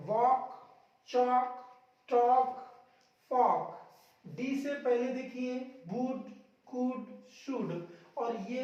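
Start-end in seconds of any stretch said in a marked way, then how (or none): 0.1-3.8 वॉक, चॉक, टॉक, फॉक one by one, no L; each word punched alone, long "aw" into K in वॉक, चॉक, टॉक, फॉक; silent L before K. Shown as a demonstration. Teacher listing silent L examples, वॉक, चॉक, टॉक, फॉक said without L. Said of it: "के से पहले".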